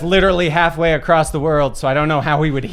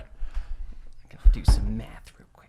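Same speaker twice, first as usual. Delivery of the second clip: whispered aside to himself; 8 semitones lower, 7 dB quieter, slower than usual. Real, not acted. Player whispers to himself while doing math. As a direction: breathe it under the table talk.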